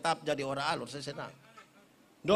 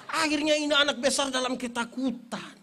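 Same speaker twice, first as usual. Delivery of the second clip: normal talking voice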